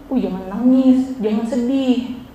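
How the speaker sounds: slow, drawn-out "don't cry"; dismissive consoling. Acted. Teacher shows students how not to console, dismissive consoling. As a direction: imitate an unhelpful friend, dragging the words slowly. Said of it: "jangan bilang gini".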